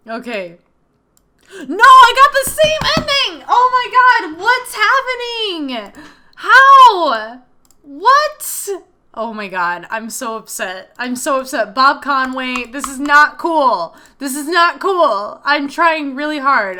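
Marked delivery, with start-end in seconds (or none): 1.7-8.7 very high, loud shrieks; shrieking disbelief. Real, not acted. Player shrieking at viewers after the same bad ending, very high and loud. 11.7-16.8 upset complaint. Real, not acted. Scolding the game's writer.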